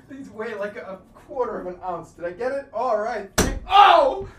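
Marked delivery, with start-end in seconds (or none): 3.7-4.3 sudden loud yelp of pain. Real, not acted. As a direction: yelp out in shock.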